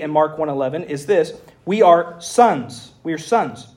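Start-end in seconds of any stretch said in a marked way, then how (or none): 1.6-2.9 very slow, each word weighted; slow emphasis. Shown as a demonstration. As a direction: spell out we are sons slowly. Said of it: "repeats line for emphasis".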